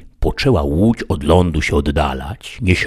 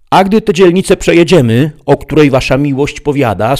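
much higher, louder, quick squire voice; Sancho asking eagerly; 9 semitones higher, 6 dB louder than usual. Acted. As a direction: press your master with a naive question. Sancho asks master.

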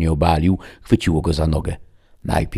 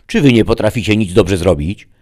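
loud, raised-pitch exasperation; angry rebuke; 4 semitones higher, 7 dB louder than usual. A narrator's reading. Lord furious at his failing servant, angry. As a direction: berate the incompetent underling.

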